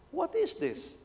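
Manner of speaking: high-pitched exasperated question; bored disbelief. Retold or imitated. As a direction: sound fed up and baffled. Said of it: "I says".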